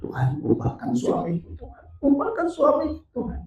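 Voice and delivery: imitated plea climbing high; pleading prayer. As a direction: beg God, pitch rising on the repeat. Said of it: "ketika dia doa sama Tuhan". (doa memohon dengan memelas)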